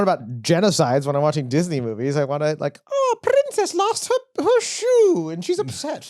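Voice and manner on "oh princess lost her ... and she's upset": very high, slow mock-cutesy storytelling; sappy fairy-tale voice. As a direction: coo it slowly in a silly high falsetto. Mimics the simple Disney story he wants.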